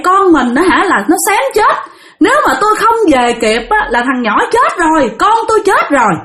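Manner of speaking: high-pitched, indignant scolding; acted-out furious reproach; angry wife. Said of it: "là bà Hương bà tức hơn nữa".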